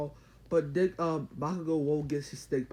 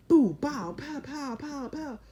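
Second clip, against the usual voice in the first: sound effects; 11 semitones higher, much slower than usual. Retold or imitated. Imitating attack sound effects.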